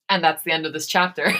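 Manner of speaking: laughing. Real, not acted. Ending chapter laughing.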